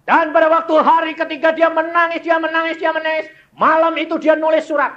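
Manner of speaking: fast, high, loud preaching cry; preaching at full force. Acted. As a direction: drive the story home with a fervent cry. Preacher's emotional climax.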